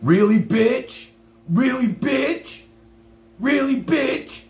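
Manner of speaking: high, slow sneering taunt; mocking interviewer. Streamer mimicking the interviewer who insulted him, sneering high. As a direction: ape his taunt, stretched and high. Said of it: "he called me a bitch".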